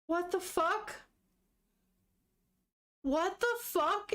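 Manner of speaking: real shock at a twist; stunned disbelief. Real, not acted. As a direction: react in real disbelief, voice lifting. Reader reacts to shocking plot twist.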